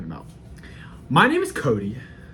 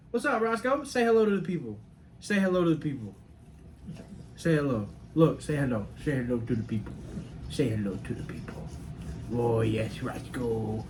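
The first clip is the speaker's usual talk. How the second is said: coaxing the dog, fading to softer; pet talk; 6 dB quieter than usual. Real, not acted. Owner coaxing his dog to greet viewers, soft pet voice. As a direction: gently urge the dog to greet.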